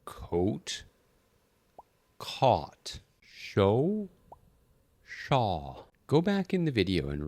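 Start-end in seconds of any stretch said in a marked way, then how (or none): 0.1-3.0 "Coat" rounded and rising, "caught" open aw; coat versus caught. Shown as a demonstration. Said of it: "let's start with". 3.2-5.9 "Show" high and rounded, "shaw" open aw; show versus shaw. Shown as a demonstration. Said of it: "let's start with".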